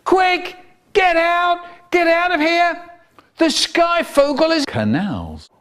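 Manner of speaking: screaming. A narrator's reading. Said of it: "and he screamed".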